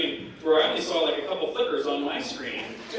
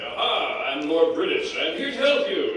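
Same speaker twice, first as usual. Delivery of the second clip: grand, raised heroic announcement; Lord British; 3 semitones higher, 5 dB louder, slower than usual. Retold or imitated. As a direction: announce yourself loudly as the royal rescuer. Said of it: "I would do it as Lord British".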